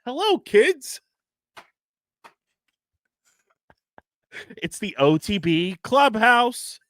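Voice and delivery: put-on high, loud, slow kids show host. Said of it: "It's gonna be like Miss Rachel or Steve from Blue's Clues".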